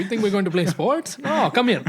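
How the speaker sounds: high-pitched imitation of a scolding parent; mock stern dad. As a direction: play a dad summoning his kids for punishment. Voices a father with bat.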